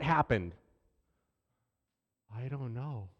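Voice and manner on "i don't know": put-on sheepish child mumble. Daughter answers dad about broken dresser.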